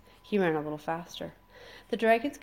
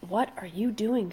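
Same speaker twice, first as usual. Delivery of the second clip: screamed; 4 semitones higher than usual. A narrator's reading. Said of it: "screamed Fish Legs".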